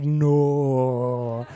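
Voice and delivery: long drawn-out no; dismayed no. Retold or imitated. Worker seeing the kid coming, drawn-out dismay. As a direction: stretch out the word in dread. Dismayed reply to kid's scream.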